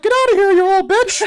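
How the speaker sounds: very high, loud rude mouse; mock-angry cartoon mouse imitation; Mickey Mouse. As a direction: squeak out an insult in cartoon falsetto. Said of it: "doing the Mickey Mouse voice".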